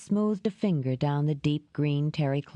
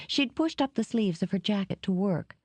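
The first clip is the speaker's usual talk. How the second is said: ordinary delivery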